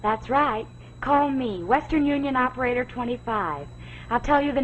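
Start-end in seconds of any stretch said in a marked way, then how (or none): none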